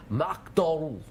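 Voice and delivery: put-on slow, high Scottish-accented name. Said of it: "he was Scottish".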